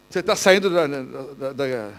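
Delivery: normal talking voice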